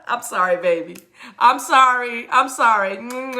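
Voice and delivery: ordinary talk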